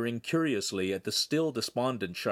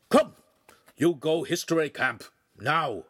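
higher, louder dog yip; yipping; 5 semitones higher, 4 dB louder than usual. Acted. Dogface ordering Handsome to history camp, yipping. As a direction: yap the order at him. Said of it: "made a face and yipped".

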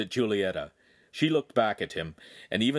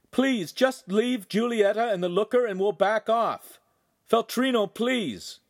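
loud, high pleading call; pleading shout; 13 semitones higher, 5 dB louder than usual. A narrator's reading. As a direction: beg at full voice from afar.

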